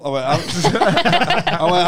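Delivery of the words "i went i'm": usual speaking voice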